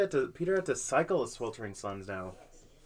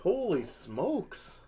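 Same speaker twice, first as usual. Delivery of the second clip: surprised; 5 semitones higher, much slower than usual. Real, not acted. Stunned praise after upset win.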